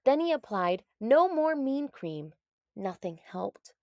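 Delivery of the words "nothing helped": usual speaking voice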